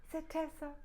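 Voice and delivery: ordinary delivery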